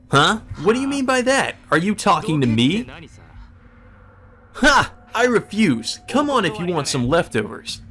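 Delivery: high, scornful taunt; taunting Shinji. Acted. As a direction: dare him with a sneer.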